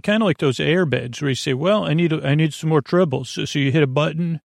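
usual speaking voice

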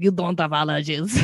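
put-on half-hearted low Russian accent. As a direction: imitate a boyfriend's Russian accent, dropping the pitch. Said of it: "he had, like, a Russian accent".

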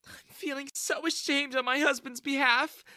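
high-pitched, tearful apology; sobbing. A narrator's reading. Wife ashamed of her husband, sobbing. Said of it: "Jessica sobbed".

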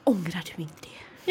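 slow, whispered question; drawn-out mock whisper; hushed old lady. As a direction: whisper it slowly, imitating a concerned old lady. Retelling old lady's nosy question.

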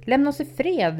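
high, loud yell at his brother; boy shouts at intruding brother, go away; shouting. A narrator's reading. Boy yells at his older brother to leave, high and loud. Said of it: "ropade Timmy".

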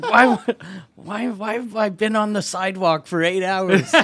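loud, high comic disbelief, imitating a friend; mock bewilderment. Acting out baffled friend on sidewalk.

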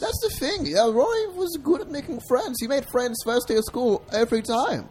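high-pitched persona telling his story; chatty high character voice; high alter ego voice. Pretend. Alter ego narrates Rory's life.

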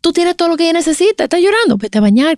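high-pitched, exasperated husband imitation; retold firm refusal. Husband refusing his crying wife's plea for milk, mock exasperated. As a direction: act out putting your foot down.

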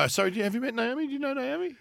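hammed-up flustered host; imitating himself. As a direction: hurry the introduction to cover the gap. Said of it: "And I go".

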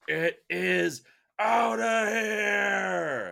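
long drawn-out shouted home run call; huge, slow broadcaster holler; Dwayne Kuiper gravelly call. Retold or imitated. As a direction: belt it high and loud, stretching every word. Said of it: "gets this, like, gravelly voice and goes".

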